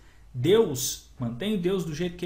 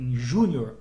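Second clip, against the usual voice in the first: usual speaking voice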